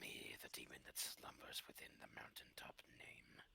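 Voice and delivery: eerie whispering demon voice. Acted. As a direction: breathe it like a buried demon. Player voices a slumbering demon.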